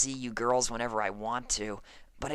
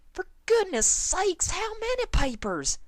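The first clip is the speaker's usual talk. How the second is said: shocked shriek; 3 dB louder than usual. Acted. Applejack shocked by the newspapers.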